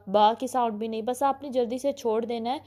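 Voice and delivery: normal talking voice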